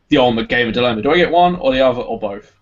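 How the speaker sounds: ordinary talk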